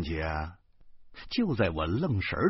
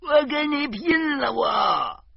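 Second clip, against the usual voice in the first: very high, strained wailing shout; birdlike screech; 4 dB louder, slower than usual. Acted. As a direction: shriek like a frantic bird. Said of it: "小贩儿鸟儿一样的叫起来了". (像鸟一样尖声嚎叫)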